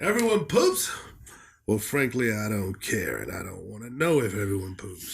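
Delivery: put-on questioning opening, then low dismissive old-man grumble; haughty eighty-year-old reviewer; put-on elderly reviewer voice. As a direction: start incredulous, drop low and measured like an offended old critic. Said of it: "Is this guy like a eighty-year-old".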